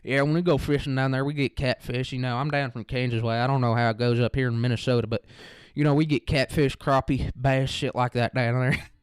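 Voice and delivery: put-on rural country accent; full country accent. Chatting fishing with a classmate, put-on country accent. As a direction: lay on a down-home country accent. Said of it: "like, a full country accent".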